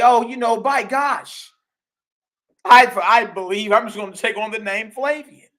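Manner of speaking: high, loud mocking voice of a smug emperor; mocking heathen. Preacher mocks Constantine taking the Flavian name, high and loud. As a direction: sneer it out like a pompous heathen. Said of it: "this heathen just say".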